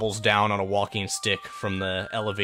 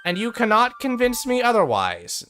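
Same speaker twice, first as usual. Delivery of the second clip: much higher, louder golem voice; golem Beta; 7 semitones higher, 3 dB louder than usual. Acted. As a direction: Beta stubbornly then halts the visitors. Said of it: "The first golem turns and says".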